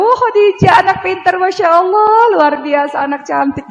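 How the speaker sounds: put-on shower the child with love; doting praise. Mother praising daughter Khadijah, high doting voice. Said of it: "saya selalu bilang".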